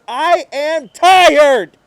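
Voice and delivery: broken, drawn-out cry; anguished cry. Acted. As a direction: drag it out in despair. Exhausted narrator breaks.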